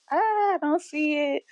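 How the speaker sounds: high-pitched, doubtful reluctance; unconvinced reaction. Retold or imitated. Podcaster recalling doubts about a casting choice, unconvinced. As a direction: pitch it up, not quite buying it. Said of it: "I was just like".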